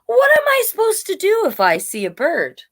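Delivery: a hint of a confused little dog; storybook dog, lost and puzzled; puzzled dog Woof. A narrator's reading. Woof asks the cow.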